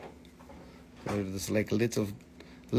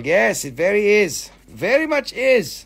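high, loud playful insistence; mock-emphatic silly phrase; 8 semitones higher, 7 dB louder than usual. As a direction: sing it up cheekily. Playful repeated odd phrase.

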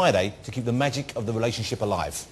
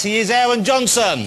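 raised, high announcer call; rousing guest introduction; 8 semitones higher, 4 dB louder than usual. Real, not acted. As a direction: present the star to the crowd. Host announces next guest.

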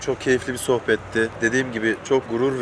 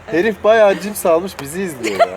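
teasing; 6 semitones higher, 4 dB louder than usual. Real, not acted. Joking about watcher, slow loud.

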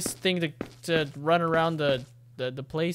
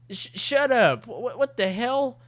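high, stuttering outburst; panicked stammer; 6 semitones higher than usual. Acted. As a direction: act rattled, tripping on the first sounds. Acting a panicked, stammering classmate.

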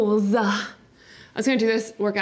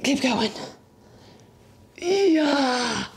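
strained cheer; 5 semitones higher, much slower than usual. Real, not acted. Pushing through effort.

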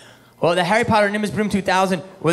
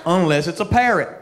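folksy Doctor Phil; 4 semitones higher than usual. Retold or imitated.

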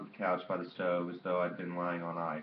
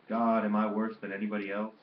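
loud, mildly anguished appeal; anguished wail; 3 semitones higher, 8 dB louder than usual. A narrator's reading. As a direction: cry out to God, without real sobbing. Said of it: "Roused to wailing and tears".

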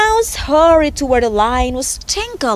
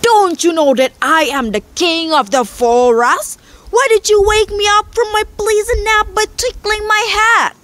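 indignant lion, rising higher and faster; restrained lion roar; 8 semitones higher than usual. A narrator's reading. Lion scolding the mouse for waking it, restrained roar. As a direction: scold as the offended king, building in pitch. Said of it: "roared the lion".

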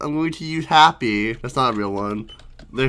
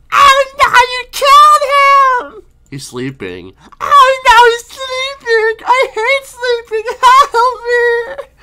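very high, crying cartoon lady; panicking Screen Lady; 8 dB louder, slower than usual. Screen Lady panics about making pizza, high crying wail.

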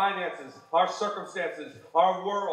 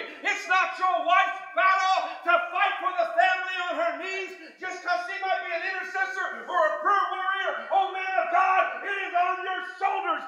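raised, ringing preacher's shout; preaching at full force; 13 semitones higher than usual. Real, not acted. Preacher exhorting the men of God, shouting. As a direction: lift it high and press the men hard.

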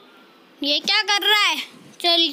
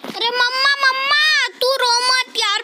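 high-pitched cry for mummy; whiny, alarmed plea; panicked pleading; 7 semitones higher than usual. Pretend. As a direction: beg frantically, pitched right up. Panicked plea to crying character.

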